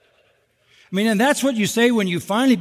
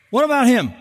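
Peter's pointed question; 7 semitones higher, 3 dB louder, faster than usual. Acted.

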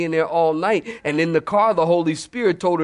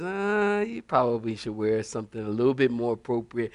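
low, softer suggestion; gentle nudge; 8 semitones lower, 5 dB quieter than usual. Retold or imitated. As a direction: offer it mildly, in a lowered voice. Holy Spirit's gentle nudge, acted.

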